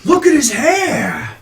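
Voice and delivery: slow, loud, high-pitched proclamation; proclaiming priest. Acted. Priest presenting a patron to the crowd, loud and proclaiming. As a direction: proclaim it grandly to a whole crowd. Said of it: "And the priest says".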